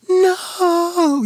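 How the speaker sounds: put-on high, long, drawn-out child's protest; kids' roared no. Little kids refusing Nana's necklace guess, loud protest. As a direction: wail a huge no like small children. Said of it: "they're, like, roaring at me".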